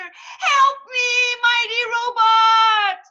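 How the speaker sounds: very high, loud, slow cry; little mouse falling, calling to his robot; crying for help. Acted. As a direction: wail while falling from the sky. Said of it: "Ricky cried".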